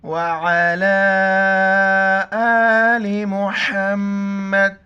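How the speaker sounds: drawn-out devotional chant of the durood; slow recitation. Real, not acted. Teacher opening the lesson with blessings, slow devotional recitation. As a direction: recite slowly and reverently to open the lesson.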